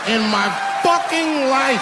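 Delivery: high, loud shout at the crowd; hammed-up exasperated yell; exasperated mock rant. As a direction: blow up on the last words.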